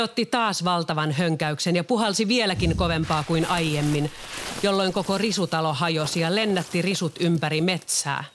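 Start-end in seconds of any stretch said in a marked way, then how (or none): none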